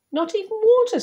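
put-on high-pitched child's protest; Tim. A narrator's reading. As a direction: pipe up high as a surprised little child. Child character asking in story.

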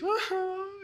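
high wordless whimper, imitating a sobbing child; imitated child's sob. Daughter waking from a bad dream, imitated sobbing. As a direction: mimic a little one's sob. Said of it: "she's sobbing down my shoulder".

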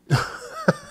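sudden squeaky laugh; a high laugh breaking out; high burst of laughter. Real, not acted. Laughs at guest's absurdity.

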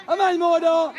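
high, loud insistent order; urging shout. Real, not acted. Coach tells player to go over, urging shout. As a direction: yell at the player to move.

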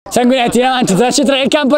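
ordinary delivery

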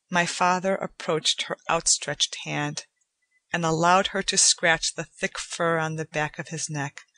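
usual speaking voice

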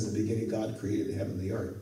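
rattled off fast in passing; a fast aside quoting Genesis; quick quote. Shown as a demonstration. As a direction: toss the verse off quickly. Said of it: "when he writes Genesis and tells us".

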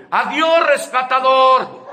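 loud, high, drawn-out mock shout of farewell. Someone on the street mockingly shouting goodbye to the rescuer. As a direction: yell it out across the street, teasing. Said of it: "te ande gritando".

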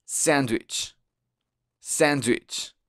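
sandwich with a fronted a, near e; English pronunciation. Shown as a demonstration. Teacher repeating for the learner, English-sounding sandwich. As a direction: model sandwich as almost sendwich. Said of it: "la A suena un poco más como una E".

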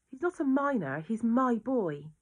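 a mother insisting her son matters, anguished; anguished plea. Acted. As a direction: hold on to "my boy". Aunt pleads about her son.

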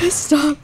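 high, sobbing laughter; plea breaking into high laughter; helpless cry-laugh. Real, not acted. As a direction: laugh until it sounds like crying. Overwhelmed after the panic.

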